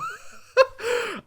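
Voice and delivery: high burst of laughter; big laugh. Real, not acted. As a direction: crack up at the memory. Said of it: "I laughed so hard".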